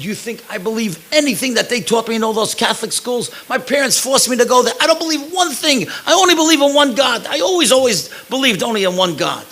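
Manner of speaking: retold fervent outburst, high and loud; impassioned protest. Acted woman's impassioned protest of faith.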